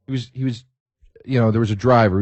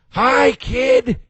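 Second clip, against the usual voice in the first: high, loud Nutty Professor squawk; Jerry Lewis; 12 semitones higher, 5 dB louder than usual. Retold or imitated. Star yelling to a fan out the car window, zany impression. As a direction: yell it out in a cartoonish, high-pitched goofball voice. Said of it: "in the fucking Jerry Lewis voice".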